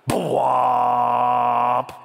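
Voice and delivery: loud, long held "bwomp"; big cinematic bwomp, drawn out; movie boom imitation. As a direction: boom out a trailer sting with your voice.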